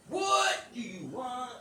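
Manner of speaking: loud, slow, high challenge; retold irritated demand. Speaker confronting arriving cops, loud and irritated. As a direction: demand it loudly, drawn out.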